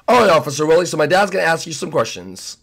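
ordinary talk

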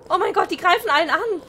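fast, high, loud alarm. Real, not acted. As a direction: rattle it off in panic. Goats attacking everyone.

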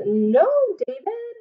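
high, slow, drawn-out scolding; stern mom. Acted. Teacher voicing mom scolding David. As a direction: scold slowly like a mother. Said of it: "she says".

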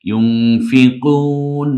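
ইউনফিকুন very slow, with a long nasal ng; nasal ikhfa. Shown as a demonstration. Teacher demonstrates like anusvara, nasal ikhfa. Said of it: "একটু নাকের বাসিতে নিয়ে".

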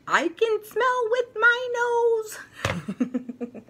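very high, slow, giggling through it; the toy bursts into giggles mid-line; laughing toy voice. Acted. Potato Head toy giggling about his new yellow nose, very high.

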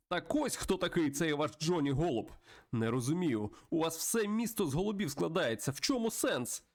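exclaiming character. Acted. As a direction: build to a high, baffled question. Voices the Raven confronting Johnny. (здивований вигук на підвищених тонах)

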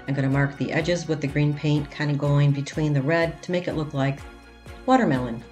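normal talking voice

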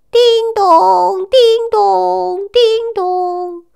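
high sing-song ding-dong; imitated doorbell chime. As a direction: ring it like a doorbell. Doorbell ringing in story. (模仿门铃声)

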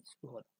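সুহদা as 'su-oda', with a plain 's'; Sanskrit pronunciation. Shown as a demonstration. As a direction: say সুহদা in three syllables, 'su' into 'oda'. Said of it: "উচ্চারণটা সংস্কৃত নিয়ম অনুযায়ী হবে". (সুহদা, সংস্কৃত নিয়মে উচ্চারণ)

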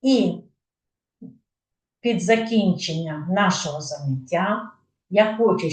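ordinary talk

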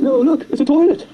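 lightly acted lawyer making a discovery; lanky lawyer's exclamation. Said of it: "the guy, the big lawyer".

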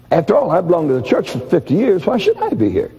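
lightly voiced indignant churchgoer, pitch climbing high; a hint of a self-righteous protest, rising to a high whine; indignant complainer. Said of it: "are complaining, saying".

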